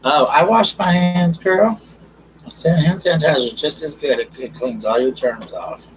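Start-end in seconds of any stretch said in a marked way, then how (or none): 0.0-4.2 put-on sassy girl voice. Voicing a chat comment sassily.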